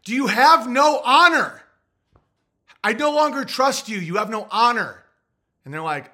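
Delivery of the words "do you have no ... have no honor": mock indignant outburst. On the phone to the shipping company, a loud lordly scolding. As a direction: scold the clerk like an offended knight. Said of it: "I literally on the phone was like".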